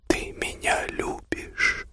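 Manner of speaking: very slow, soft whisper. A narrator's reading. Woman longing to ask her lover, whispering slowly. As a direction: draw the question out in a bare whisper.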